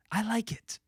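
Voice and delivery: a guilty little whisper. Real, not acted. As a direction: whisper it like a confession. Sheepish confession after negative comments.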